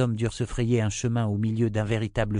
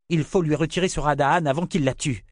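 higher, louder and pressing; urgent insistence, higher and louder; 7 semitones higher, 4 dB louder than usual. Acted. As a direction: demand it before it's too late. Richard urgent to save her.

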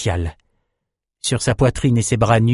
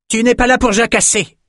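high, raised-voice scolding; shouted rebuke; 13 semitones higher, 4 dB louder than usual. A narrator's reading. Adessa cuts off the fighters' chatter, shouted rebuke. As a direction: cry it out sharply as an order. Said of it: "cria Adessa".

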